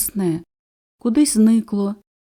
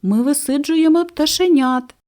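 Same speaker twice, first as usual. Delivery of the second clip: higher, louder small-bird voice; busy little birds; 7 semitones higher, 4 dB louder than usual. A narrator's reading. Nesting birds answering the cuckoo, high and busy. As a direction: chirp it out, too busy to play. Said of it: "цвірінчали пташки".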